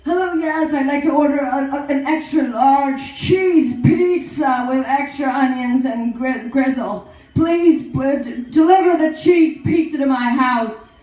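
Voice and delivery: loud cell-phone caller bellowing a pizza order; acted-out phone order. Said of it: "so I'm gonna do an act out here".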